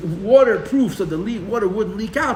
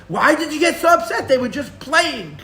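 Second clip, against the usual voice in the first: mock innocent protest; 7 semitones higher, 5 dB louder than usual. Mocking the defenders.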